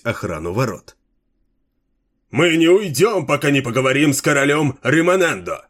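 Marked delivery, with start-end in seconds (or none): 2.3-5.6 shout. A narrator's reading. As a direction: yell it back at the guards. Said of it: "закричал предводитель собравшихся".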